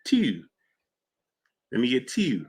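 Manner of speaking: soft Baltimore "two" said as "tew"; Baltimore accent. Retold or imitated. Streamer teasing Baltimore relatives' talk, in a soft Baltimore accent. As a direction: mimic the Baltimore fronted vowel on "two". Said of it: "in Baltimore".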